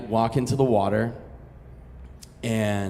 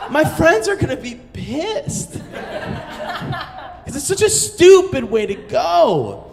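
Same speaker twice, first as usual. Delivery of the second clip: panicked disbelief. Retold or imitated. Inner panic about dying.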